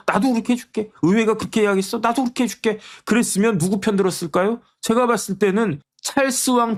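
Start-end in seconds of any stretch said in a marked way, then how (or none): 0.1-2.8 eager king promising. Retold or imitated. King Charles voiced making an offer.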